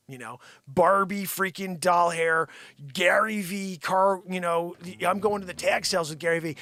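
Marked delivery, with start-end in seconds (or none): none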